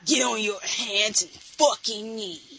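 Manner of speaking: low gruff action hero; Duke Nukem voice. Pretend. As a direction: growl it like a bad Duke Nukem. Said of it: "a really bad Duke Nukem".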